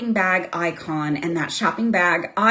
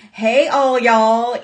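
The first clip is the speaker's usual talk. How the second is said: slow, drawn-out Texas drawl; big Texas accent; 4 dB louder, slower than usual. Acted. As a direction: greet them in a broad, slow Texas accent. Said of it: "in her big Texas accent".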